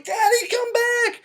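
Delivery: put-on very high, drawn-out child's wail; crying little girl. Little girl begging her father back, mock crying. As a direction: imitate a little girl calling out in tears. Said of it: "she's crying 'cause she's like".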